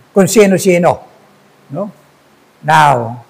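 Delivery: usual speaking voice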